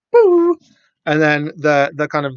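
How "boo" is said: high, loud, sustained "boo" sound; imitated whoosh noise. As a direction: make a high mock sci-fi effect. Imitates alien revealing the truth.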